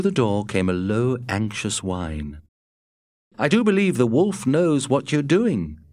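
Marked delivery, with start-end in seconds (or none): 3.4-5.8 lightly voiced, higher Matt; a hint of Matt, pitched up; Matt's voice. A narrator's reading. Matt telling Scott the wolf knows, lightly voiced higher. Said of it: "Matt said".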